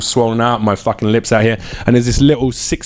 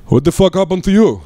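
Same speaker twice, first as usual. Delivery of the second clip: higher-pitched mock astonishment; imitated shocked question; 6 semitones higher than usual. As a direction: act out the club owner taken aback. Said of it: "Like this, and I'm like".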